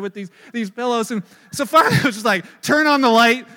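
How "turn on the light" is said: shouted order to flip the lights on; loud dad calling into a dark bedroom; loud command. Retold or imitated. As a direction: bark it as a dad cutting off the game. Dad bursts in on boys.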